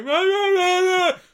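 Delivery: very loud, high wordless blare; mimicked loud jabbering. Diner mimicking the loud guy chomping away, blaring. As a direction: bellow nonsense like a noisy eater. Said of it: "Really loud the whole time".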